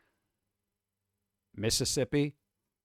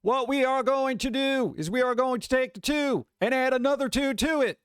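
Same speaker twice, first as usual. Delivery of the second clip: much higher, louder dopey coach voice; goofy jock teacher doing arithmetic; mock gym teacher; 10 semitones higher, 3 dB louder than usual. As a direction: play a meathead gym teacher fumbling a math lesson. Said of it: "you want your gym teacher teaching math".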